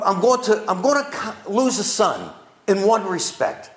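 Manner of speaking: ordinary delivery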